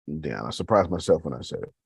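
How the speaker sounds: ordinary talk, nothing marked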